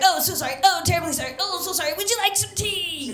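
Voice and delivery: put-on high, fussy English boyfriend apologizing; mock a very polite English accent; apologetic English accent. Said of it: "he apologized for everything".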